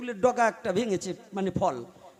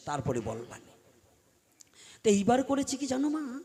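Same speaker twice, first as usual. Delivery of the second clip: ordinary talk, nothing marked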